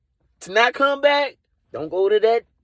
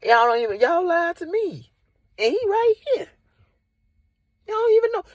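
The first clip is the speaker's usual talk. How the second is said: pitched way up, indignant rant at the congregation; outraged disbelief; 4 semitones higher than usual. Retold or imitated. To churchgoers who never told him about Jesus, high indignant squeal. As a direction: squeal out the betrayal, voice climbing high. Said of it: "I'm like".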